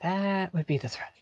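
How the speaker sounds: near-whisper, very soft; hushed aside. Acted. Player reacting to someone heard downstairs, hushed aside. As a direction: whisper it under your breath.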